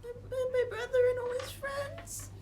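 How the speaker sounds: put-on high, teary kid voice; crying child. Kid confesses about the dog, crying. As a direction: weep it out like a guilty little boy.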